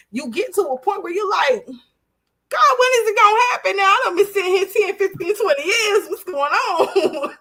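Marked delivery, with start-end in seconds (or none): 1.7-7.4 high, loud, rushed complaint to God; hammed-up desperate whine; exasperated plea. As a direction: plead impatiently, stretching the last words into a laugh. Acting out impatient plea to God.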